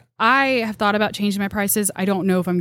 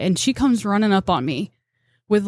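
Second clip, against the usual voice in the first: normal talking voice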